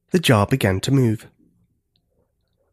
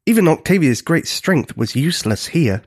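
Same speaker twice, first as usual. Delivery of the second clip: ordinary delivery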